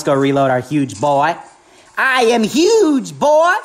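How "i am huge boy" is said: drawn-out hype. Acted. Hamming up his weapon's name.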